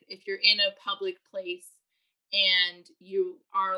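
usual speaking voice

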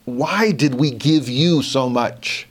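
slow, pained rebuke from on high; grieved, slow reproach; righteous indignation. Pretend. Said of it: "but righteous indignation".

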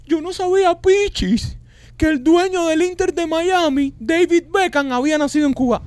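high, sing-song fake-innocent voice; mock naive surprise. Mocking critics who claim not knowing Beckham's partners. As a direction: play dumb like a clueless gossip.

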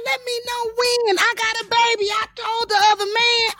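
very high-pitched, worked-up retort; mad protest. Retold or imitated. Broke mother demands an answer after her audition, mad. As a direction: act out fuming at the brush-off. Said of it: "I got so mad".

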